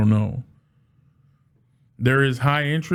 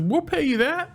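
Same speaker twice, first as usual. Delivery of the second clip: eager mock offer; 14 semitones higher than usual. Competitor voiced offering money.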